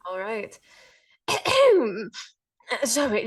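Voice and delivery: ordinary talk, nothing marked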